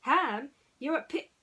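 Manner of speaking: high, loud blurt cut short; Woody blurting. Acted. Woody nearly calling Ham a pig, loud blurt. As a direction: blurt it then catch yourself.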